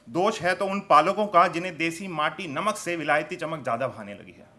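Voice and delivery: emphatic storytelling verse, rising then falling; poetry recitation. Acted. Poet pleads for forgotten desi toys, declamatory recitation. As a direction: declaim each line to a live crowd.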